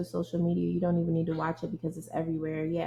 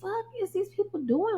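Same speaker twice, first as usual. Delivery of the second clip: much higher, exasperated outburst; exasperated disbelief; 13 semitones higher than usual. Retold or imitated. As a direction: throw up your hands at these people. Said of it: "I be like".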